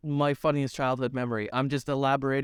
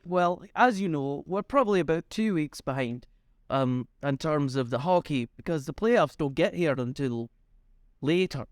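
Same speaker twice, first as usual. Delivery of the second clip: ordinary talk, nothing marked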